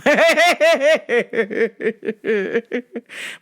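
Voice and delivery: high rising giggle; giggling laugh. Real, not acted. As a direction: crack up. Laughing at punchline.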